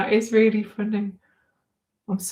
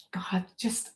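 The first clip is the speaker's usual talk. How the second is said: whispered; 12 dB quieter than usual. Real, not acted. Recovering after a laughing fit.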